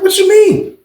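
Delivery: high, sharp disbelief, jumping up in pitch; incredulous. Retold or imitated. Inmate to guard asking for food, high incredulous snap. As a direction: throw it back in total disbelief.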